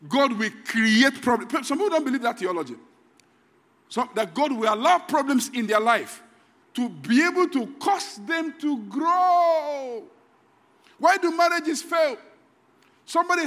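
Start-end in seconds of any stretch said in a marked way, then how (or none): 0.1-1.7 slow, weighty pulpit statement; slow, louder declaration; slow emphatic. Real, not acted. Slow emphatic sermon point. 6.8-10.0 high, drawn-out close; slow rising preach; slow stretched ending. Real, not acted.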